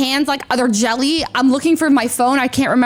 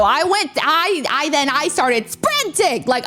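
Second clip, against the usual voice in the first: ordinary talk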